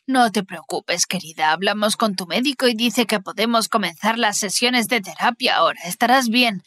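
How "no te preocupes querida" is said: tender comfort. Acted. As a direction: ease her fears gently. Mother comforts Alice.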